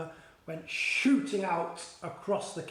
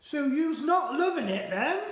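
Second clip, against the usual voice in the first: innocently; 9 semitones higher, 5 dB louder than usual. Acted. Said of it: "the B F G asked innocently".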